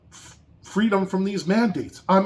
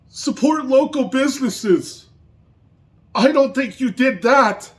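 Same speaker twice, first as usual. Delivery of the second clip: higher, louder furious rant; real anger; 6 semitones higher, 4 dB louder than usual. Real, not acted. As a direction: let the outrage boil over. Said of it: "I am so angry".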